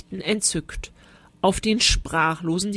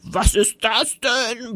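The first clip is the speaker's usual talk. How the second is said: horrified bellow; 3 semitones higher, slower than usual. A narrator's reading. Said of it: "brüllte er entsetzt".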